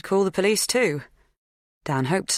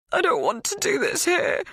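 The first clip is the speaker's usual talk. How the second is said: tearful. A narrator's reading. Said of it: "tears flooding my eyes".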